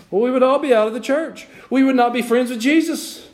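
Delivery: high-pitched, emphatic sermon cry; impassioned preaching. Real, not acted. Preacher warning congregation about judging others, high and fervent. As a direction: press the point hard on the congregation.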